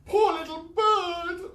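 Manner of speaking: very high, slow wail. Acted. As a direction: mourn the bird aloud. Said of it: "wailed Miss Gum".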